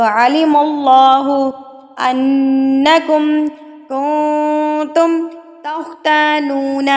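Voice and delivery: slow melodic recitation with a heavy kha; Quran recitation. Shown as a demonstration. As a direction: chant the verse slowly. Tajweed recitation of verse.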